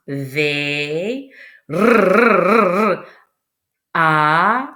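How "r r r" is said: long rolled trilled R; sounded-out R. Shown as a demonstration. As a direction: draw out the R as a rolling trill. Sounding out letters of avril.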